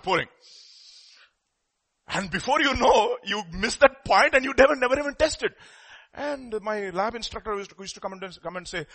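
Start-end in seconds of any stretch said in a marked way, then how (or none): none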